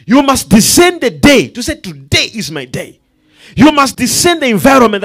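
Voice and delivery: loud, high, driving preacher cadence; full-force preaching. Acted. Preacher exhorting the congregation, loud and rising. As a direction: hammer each "discern" home to the church.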